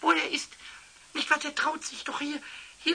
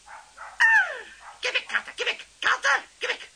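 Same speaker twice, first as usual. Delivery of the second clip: frightened cry; 7 semitones higher than usual. Acted. Pumuckl fleeing the cat.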